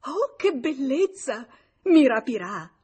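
high, loud burst of delight; thrilled, jubilant outcry; overjoyed. A narrator's reading. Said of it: "fece un balzo di gioia".

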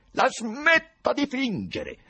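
loud, high, drawn-out threat; menacing. A narrator's reading. Ivan confronts the professor for pretending, menacing. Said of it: "disse minaccioso Ivan".

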